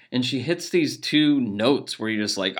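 ordinary delivery

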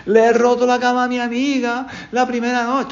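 high-pitched horrified gasp of realisation; panicked dismay. Retold or imitated. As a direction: relive the shock of waking to the broken bed.

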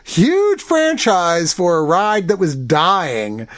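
ordinary talk

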